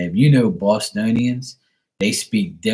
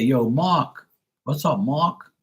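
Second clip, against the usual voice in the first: "Mark" with no R, said "Mak" in a Bermudian accent; how Bermudian friends greet Mark, R dropped; 4 semitones higher, slower than usual. Shown as a demonstration. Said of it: "They say".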